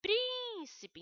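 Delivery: Príncipe called out high and loud, stress on prín; teacher calls out Príncipe to show the first syllable is strong; calling the word out. Shown as a demonstration. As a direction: call out Príncipe like summoning someone, hitting prín. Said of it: "Vamos chamar o príncipe?".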